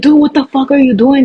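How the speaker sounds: retold yell, high and loud; imitating a startled bystander's yell; mock yell. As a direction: shout it at him. Neighbours yelling at attacker.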